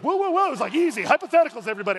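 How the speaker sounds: high, loud whoa to calm an angry room; calming yell. Retold or imitated. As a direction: throw up your hands and settle them down, loudly. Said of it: "And he was like".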